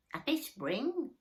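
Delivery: put-on slow, high creaky old worm; elderly earthworm. A narrator's reading. Elderly earthworm greeting Moomin Troll, slow and high. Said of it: "said an elderly earthworm".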